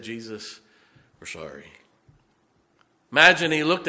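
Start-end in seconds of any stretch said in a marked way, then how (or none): 0.0-1.7 much softer and lower, sheepish; ashamed apology. Acted. Ashamed disciples apologizing to Jesus, soft and sheepish. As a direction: hang your head and say sorry.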